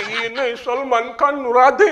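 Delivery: a touch of excited widow; lightly voiced widow. Widow insisting on Salman Khan's Radhe, mock high voice. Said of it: "મને કે".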